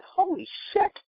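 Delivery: high-pitched mock shock; a high, amazed holy shit. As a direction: squeak it out in disbelief. Shocked at meeting her.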